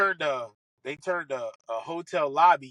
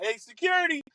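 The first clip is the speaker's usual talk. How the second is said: mock call for security; 8 semitones higher than usual. Calling security on his golfer as joke.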